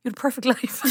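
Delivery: words dissolving into a high laugh. Real, not acted. Laughing at her own joke about the character's life. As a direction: laugh through it.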